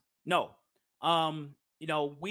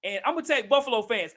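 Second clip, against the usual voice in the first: ordinary talk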